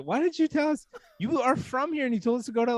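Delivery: retold protest, pitched high; high-pitched mock indignation; incredulous complaint. Traveler challenging his local friend, incredulous.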